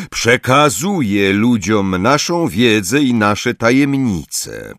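usual speaking voice

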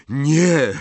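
a loud, high, breathless no; Harry gasping in horror; gasped no. A narrator's reading. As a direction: gasp it out in shock. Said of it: "wydyszał Harry".

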